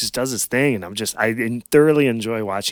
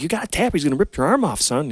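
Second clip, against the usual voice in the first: urgent telling; 7 semitones higher than usual. Retold or imitated. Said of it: "I'm telling him".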